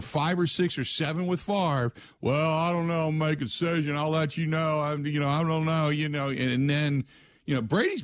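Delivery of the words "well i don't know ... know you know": higher, hesitant dithering voice; waffling quarterback. Retold or imitated. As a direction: hem and haw, unsure. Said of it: "the Brady will he or will he not waffle".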